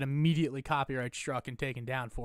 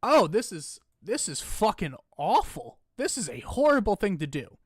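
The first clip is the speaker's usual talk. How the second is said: louder, higher, hammed-up dismay; overdone shocked grief; mock horror; 6 semitones higher, 7 dB louder than usual. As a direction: act appalled at the death. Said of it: "or do you go".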